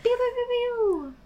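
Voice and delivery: high zappy laser pews; laser pew sounds. Pretend. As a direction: fire off little laser blasts.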